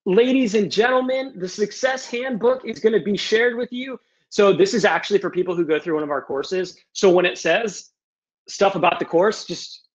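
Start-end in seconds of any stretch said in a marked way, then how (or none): 0.0-4.0 high-pitched showman's announcement; mock grand announcer. Host presents a handout to the group, mock-grand.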